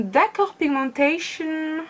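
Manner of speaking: high, drawn-out amazed reaction; impressed surprise. Real, not acted. Vlogger reacting to intense eyeshadow colour, impressed surprise. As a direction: stretch it out high, astonished.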